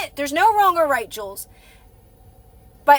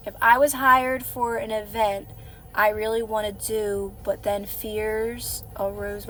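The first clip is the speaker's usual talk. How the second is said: reading under breath; 4 semitones lower, 7 dB quieter, slower than usual. Real, not acted. Mutters viewer's comment while reading.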